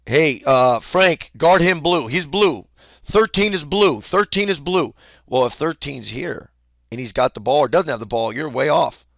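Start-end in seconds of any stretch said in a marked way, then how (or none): 0.1-5.0 loud, high call-out to a player; raised, higher sideline call; coach's yell. Shown as a demonstration. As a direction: yell instructions across the court. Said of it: "I yell at you".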